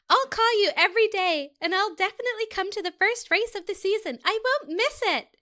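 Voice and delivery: put-on sweet girlfriend voice; girly voice. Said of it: "just a girly accent".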